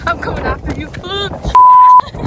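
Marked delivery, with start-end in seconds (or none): 1.0-2.1 high, loud burst of laughter; loud shrieking laugh. Real, not acted. Loud laugh while chased.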